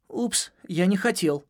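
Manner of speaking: slow, sheepish little squeak; embarrassed. Acted. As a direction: apologise as if caught out. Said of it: "сконфузился он".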